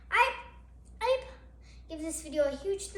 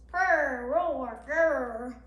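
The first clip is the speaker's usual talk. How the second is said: mock animal growls; 2 semitones lower, 5 dB louder, much slower than usual. Animal sounds from the book.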